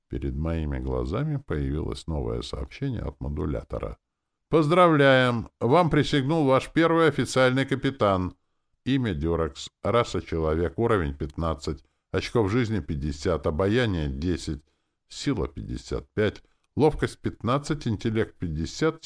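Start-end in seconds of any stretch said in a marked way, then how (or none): 4.5-12.8 high, loud congratulatory announcement; game announcer. Acted. Game system tells player a captain swore fealty, announcing. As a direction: announce the news grandly, drawing out the congratulations.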